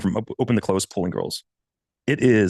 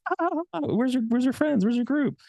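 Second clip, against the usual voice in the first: squeaky pitched-up mock women's voice; imitating teasing women. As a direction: tease like an amused woman, pitched way up. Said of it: "they're like".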